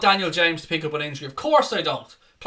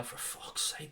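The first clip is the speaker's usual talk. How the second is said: whispered, much softer despairing mutter; muttered curse; 17 dB quieter, slower than usual. Real, not acted. Fan despairs at a wasted pass, whispered muttering. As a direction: breathe it out under your breath.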